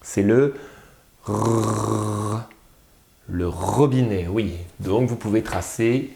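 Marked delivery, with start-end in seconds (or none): 1.2-2.4 "r" held as one long roll; isolated sound. Shown as a demonstration. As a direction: keep "r" rolling on a level note. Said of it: "quel est l'alpha qui commence par r".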